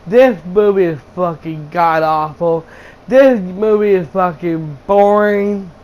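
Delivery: normal talking voice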